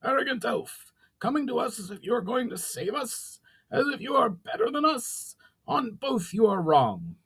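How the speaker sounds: high, piercing goblin voice, slowing at the end; shrieking fat goblin. Acted. Fat goblin sneering at the arrogant elf, high shrieking voice. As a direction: screech your contempt at the elf, slowing down as you finish. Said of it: "The fat goblin let out a loud shriek".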